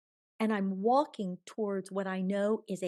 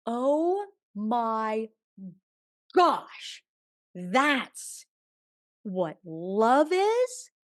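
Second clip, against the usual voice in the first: higher and louder in sudden wonder; awestruck; 3 semitones higher, 8 dB louder, much slower than usual. Retold or imitated. Reliving the moment she felt divine love, awestruck and loud. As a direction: gasp it out like a revelation hitting you.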